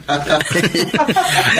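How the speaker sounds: a pleased, easy chuckle; hearty laugh. Acted. Friend laughing at Bert's lucky win, easy chuckle.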